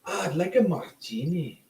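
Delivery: British spy sliding toward Scottish; high, badly faked British-Scottish accent; attempted British accent. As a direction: try British, drift into Scottish. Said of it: "Now are you doing Scottish?".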